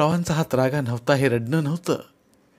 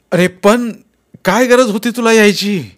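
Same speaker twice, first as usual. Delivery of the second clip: much higher and louder angry snap; snapping; 9 semitones higher, 9 dB louder than usual. Acted. Father snaps at son who came back, angry snap. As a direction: bark it at him. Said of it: "तसे ते खेकसल्यासारखे म्हणाले".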